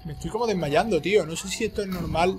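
real alarm as vision fades. Real, not acted. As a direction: panicky disbelief at the screen. Character fainting in game.

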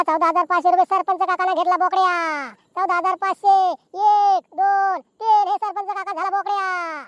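drawn-out final count; auctioneer's closing call. Acted. Auctioneer declaring the goat sold to sarpanch, closing call. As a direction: sing out the sale to the crowd. Announces the winning bid.

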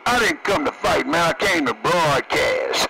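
usual speaking voice

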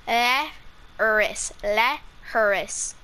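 leabharas drawn out slowly as lyow-ras; careful Irish word. Shown as a demonstration. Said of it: "oh, sorry".

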